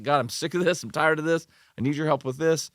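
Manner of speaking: imitating a forceful, frustrated plea to God; aggressive prayer. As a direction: act out praying aggressively, pitched high. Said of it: "I'm aggressive about it".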